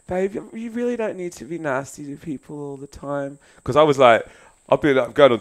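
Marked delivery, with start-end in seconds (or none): 0.1-3.4 soft, kind plea to a partner; gently coaxing him to be nicer; gentle and kind. Retold or imitated. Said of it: "she's so gentle and kind".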